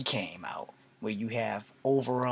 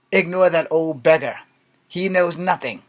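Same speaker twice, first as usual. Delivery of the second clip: loud, raised stage dismissal; hammed-up Shakespearean command; Mark Anthony; 5 semitones higher, 10 dB louder than usual. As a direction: declaim it dismissively to the court. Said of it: "playing Mark Anthony".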